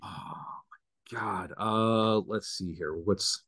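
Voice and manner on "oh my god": whispered under the breath; embarrassed whisper. Real, not acted. Host put on the spot, whispering to herself in embarrassment. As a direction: breathe it out, flustered and caught out.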